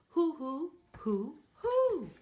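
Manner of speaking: high, loud owl hoots. Acted. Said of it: "How about like an owl?".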